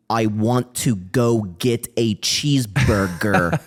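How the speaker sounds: put-on slow, over-deliberate American accent; mock American accent. Foreigner asked to talk American, slow exaggerated American accent. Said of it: "in a American accent".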